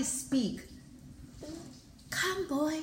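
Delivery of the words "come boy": put-on softer, higher, faintly old-lady voice; lightly voiced frail old woman calling; a hint of an aged tree calling the boy. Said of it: "Sound like she getting old too".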